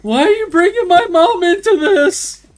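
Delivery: high, loud mock-indignant whine; mock offended. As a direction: squeal it like someone offended.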